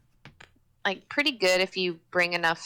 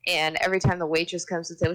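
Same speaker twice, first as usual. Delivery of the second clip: normal talking voice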